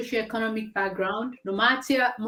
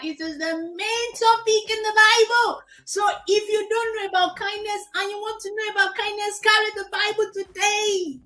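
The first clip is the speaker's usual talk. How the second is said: preaching; 10 semitones higher than usual. Acted. Preaching about the Bible.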